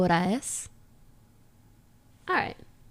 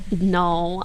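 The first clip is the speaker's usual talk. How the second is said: ordinary talk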